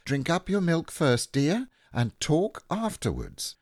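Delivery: high motherly instruction; Kanga as mother. A narrator's reading. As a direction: gently tell a little one off, pitched well up. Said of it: "Kanga said to Roo".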